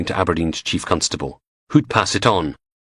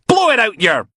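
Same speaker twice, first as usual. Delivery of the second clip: much higher, louder; shouted insult; 5 dB louder than usual. Acted. Logan shouting down the DI. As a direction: yell it over them.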